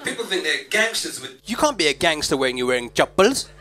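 ordinary talk

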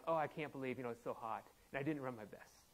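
low, soft humble-brag complaint; mock self-deprecation. Preacher showing how people bring themselves down to brag, mock self-deprecation. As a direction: play at putting yourself down, voice dropped. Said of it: "by bringing yourself down".

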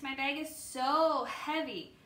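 "so" stretched long and slow; drawn-out emphasis. Acted. Lance complaining to the policeman, dragging out "so". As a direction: lean hard and long on "so".

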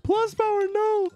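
a sudden high wail of loss; anguished cry. Real, not acted. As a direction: yell out as your friend goes down. Teammate gets killed in game.

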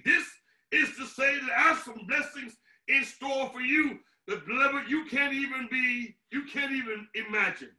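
ordinary talk, nothing marked

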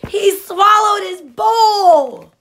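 loud, high, drawn-out reveal; astonished narration. A narrator's reading. Reader revealing Pig's mishap, loud amazed exclamation. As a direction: draw it out in amazement. Shocked climax of the story.

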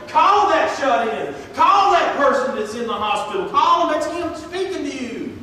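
a preacher voicing God's insistent call; God's urging voice. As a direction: boom out God's nudge like a command. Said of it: "That's God's small voice telling you".

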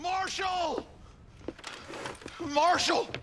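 deputy hollering for the marshal; calling out. Acted. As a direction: call him from far across town.

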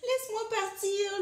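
high pleading creature voice; storybook captive's high plea; jellyfish pleading. A narrator's reading. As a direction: plead high, like trapped prey. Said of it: "lui dit Méduse".

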